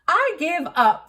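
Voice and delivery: high, loud exasperated shout. A narrator's reading. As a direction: shout in defeat. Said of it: "the king shouted".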